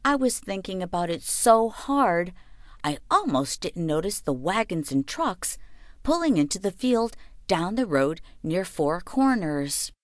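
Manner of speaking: ordinary delivery, nothing marked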